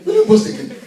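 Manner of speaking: the crowd teasing Moses again; mocking taunt. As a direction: needle him with a laughing taunt. Mocking question repeated.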